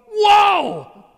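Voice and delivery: high, loud shout of wonder; whole congregation in awe; amazed whoa. Pretend. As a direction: cry out in pure amazement. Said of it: "we're all going".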